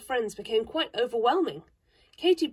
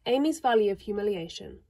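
plain reading voice

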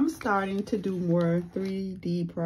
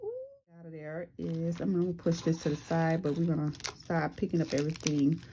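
normal talking voice

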